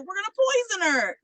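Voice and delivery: high-pitched mock glee; gleeful plotting. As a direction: announce the plot like an eager schemer. Girls announce their plan, retold.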